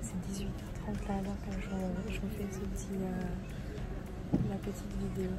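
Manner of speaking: soft, hushed voice; quiet voice. Real, not acted. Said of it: "Je parle doucement".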